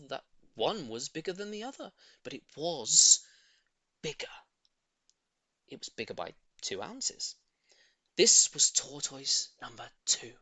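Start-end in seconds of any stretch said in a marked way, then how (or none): none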